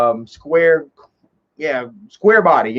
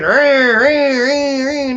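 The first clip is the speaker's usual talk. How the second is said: engine revving; 10 semitones higher, much slower than usual. Retold or imitated.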